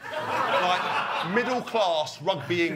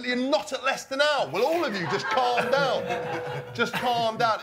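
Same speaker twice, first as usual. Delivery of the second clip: laughing mock scolding; 7 semitones higher, 3 dB louder than usual. Quoting himself telling Lewis off.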